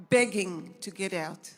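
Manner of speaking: normal talking voice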